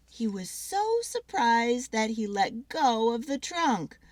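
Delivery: ordinary delivery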